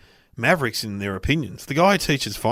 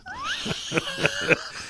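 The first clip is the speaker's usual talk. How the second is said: a sudden high burst of laughter; 7 dB quieter than usual. Real, not acted. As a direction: laugh out high at the silly mistake. Laughing at penis misreading.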